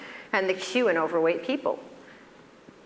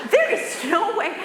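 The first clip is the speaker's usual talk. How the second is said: high, laughing protest; can't believe it, laughing, higher; laughing disbelief; 13 semitones higher, 3 dB louder than usual. Real, not acted. Telling patients about the cookie, laughing disbelief.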